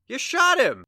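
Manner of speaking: a horrified shout at the shooter; shocked shout. Acted. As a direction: gasp it out in disbelief. Witnessing a shooting.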